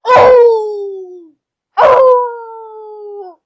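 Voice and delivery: loud, long, drawn-out howl; sustained high wolf howl. Acted. Wolf howls heard in camp.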